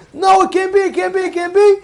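high, repetitive mock protest; retold screaming. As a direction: imitate someone shouting down a rabbi. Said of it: "he was screaming and screaming and screaming".

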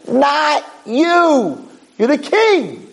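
very high mock yell; hammed-up high-pitched protest; exasperated outcry. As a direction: wail it in appalled disbelief, acting out the advisors. Advisors protest to their king.

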